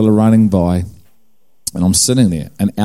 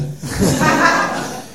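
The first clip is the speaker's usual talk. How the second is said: high laugh; 3 dB quieter than usual. Real, not acted. Laughs after saying yeah.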